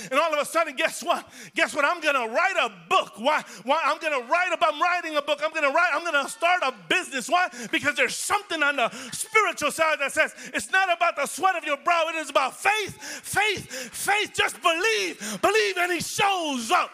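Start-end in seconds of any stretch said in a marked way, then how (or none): 0.1-10.0 higher, louder, eager and quick; excited preaching. Real, not acted. 10.5-16.9 high, loud preaching shout on faith and believe. Real, not acted. Voice of spirit, shouted.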